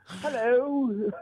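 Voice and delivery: high-pitched mock British hello; British accent. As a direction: put on a silly British accent. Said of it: "should have put on a British accent".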